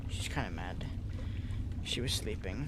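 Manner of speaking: hushed whisper so the dog won't hear; whispering about the sleepy grumpy dog. Real, not acted. Dog was woken up.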